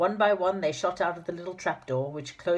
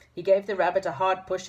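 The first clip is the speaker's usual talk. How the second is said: ordinary delivery, nothing marked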